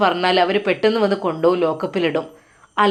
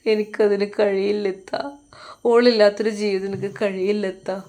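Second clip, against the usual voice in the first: crying. A narrator's reading. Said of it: "അവൻ കരഞ്ഞുകൊണ്ട് പറയുന്നു".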